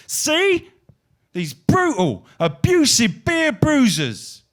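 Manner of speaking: rhythmic, declaiming spoken-word poem; poetry performance. Acted. Poet performing to a live audience, rhythmic declaiming. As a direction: perform the poem to the crowd with swagger.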